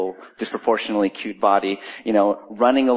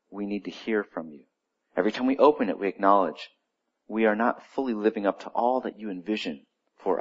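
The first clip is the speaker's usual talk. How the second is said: hushed, softer prayer voice; 4 semitones lower, 6 dB quieter than usual. Acted. Pastor praying over the congregation before the sermon, hushed prayer. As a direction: pray quietly, drawing the church in.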